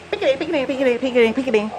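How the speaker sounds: ordinary talk, nothing marked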